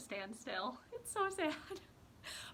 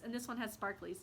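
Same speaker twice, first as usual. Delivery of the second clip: ordinary delivery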